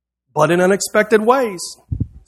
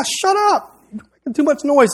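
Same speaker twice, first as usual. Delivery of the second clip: much higher, squeezed-up mock annoyance; mock scolding; 8 semitones higher than usual. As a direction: imitate annoyed disciples shushing a noisy man. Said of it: "They were telling the guy".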